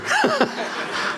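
breathy laugh. Real, not acted. Laughs at own honesty.

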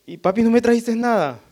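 mock child disappointed; child meeting dad at the door, complaining; whiny child. Child greeting dad, demanding.

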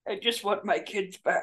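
quiet, understated tough-dad threat; Liam Neeson impression. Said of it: "That's Liam Neeson".

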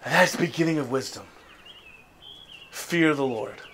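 soft, low preaching into prayer; quiet sermon close. Real, not acted. Preacher calling the people to fear God softly. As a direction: bring it down to a hush.

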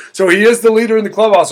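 fast and loud hype; excited. Real, not acted. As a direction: hype it up quickly. Excited announcement.

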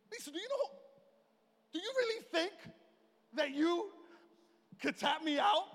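high, halting disbelief; acted frustration. Husband challenging wife's belief she can win, acted frustration. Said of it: "I just got frustrated".

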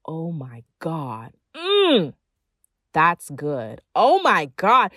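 drawn-out pretend food rapture; savoring food. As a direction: relish every bite out loud. Demo savouring good food at dinner.

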